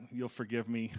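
ordinary talk, nothing marked

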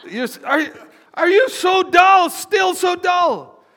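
high, loud, slow hammed-up shout; exasperated shout. Jesus shouting at dull disciples, loud exasperated cry. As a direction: bellow the rebuke like Jesus losing patience.

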